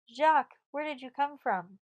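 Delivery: high, loud surprise; surprised. Acted. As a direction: call out in surprise. Surprised greeting at night.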